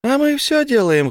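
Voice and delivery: very high gleeful fairy; squeaky delighted pixie. A narrator's reading. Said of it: "хлопнула в ладоши блондинка".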